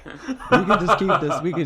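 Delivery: laughing through the words; high giggly speech. Real, not acted.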